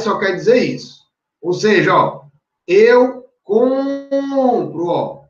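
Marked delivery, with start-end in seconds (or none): 2.7-4.9 eu compro, stretched slow and high; slow, emphatic "eu compro"; stressed verb form. Shown as a demonstration. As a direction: draw out "compro" to show the stressed syllable. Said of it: "veja que tá aqui o radical".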